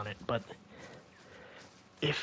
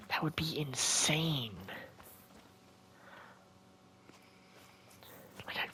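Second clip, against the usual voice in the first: whispering; 5 semitones lower, 4 dB quieter than usual. Real, not acted. Hushed aside while hunting.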